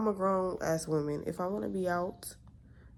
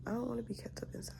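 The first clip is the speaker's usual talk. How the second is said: soft unvoiced whisper of her wish; whispered aside; 6 semitones higher, 10 dB quieter, much faster than usual. Real, not acted. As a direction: breathe it out under the breath. Whispered aside repeating her point.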